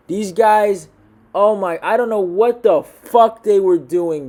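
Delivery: loud, slow, disgusted ranting; angry rant. Real, not acted. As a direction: spit out the disgust at the fighters. Ranting about a bad fight.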